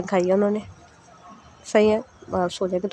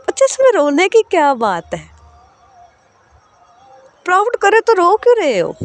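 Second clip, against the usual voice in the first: higher, louder amused disbelief; smiling sister, teasing; 9 semitones higher, 4 dB louder than usual. Acted. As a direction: tease her fondly for crying. Sahar smiles, teases tearful Raavi.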